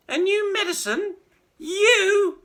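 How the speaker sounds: put-on high, loud sneering old woman; old grandma mocking George; scornful crone yell. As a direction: scoff at the boy. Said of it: "she yelled".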